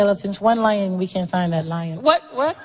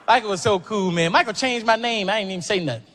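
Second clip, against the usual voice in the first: ordinary delivery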